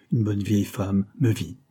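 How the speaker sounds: usual speaking voice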